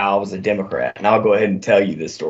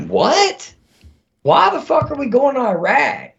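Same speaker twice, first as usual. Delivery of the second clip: incredulous. Retold or imitated. Retelling own shocked reaction.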